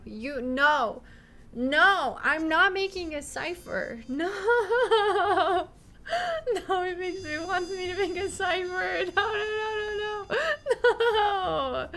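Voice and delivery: high, loud laughing protest; protesting shout. Real, not acted. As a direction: yell no in laughing despair. Refuses to make a cipher.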